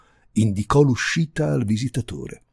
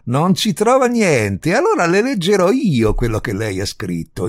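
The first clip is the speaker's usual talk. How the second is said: loud, raised, incensed repeat; ranting; 8 semitones higher, 8 dB louder than usual. A narrator's reading. Visitor threatening to read out her article, ranting. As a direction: storm on indignantly. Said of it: "strepitò l'altro".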